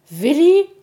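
high, loud questioning yelp of a name; startled. A narrator's reading. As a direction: blurt it in alarm. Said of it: "erschrak Puh".

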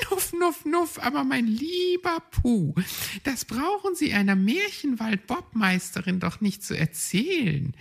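high, snuffling hedgehog lady; proud hedgehog bob champion snuffling. Acted. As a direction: snuff like a hedgehog, then brush him off. Frau Igel snuffles proudly.

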